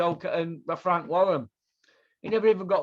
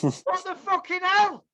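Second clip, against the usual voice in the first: can't believe it, near a squeak; outraged disbelief; 10 semitones higher than usual. Real, not acted. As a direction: pitch shoots high, slow and incredulous.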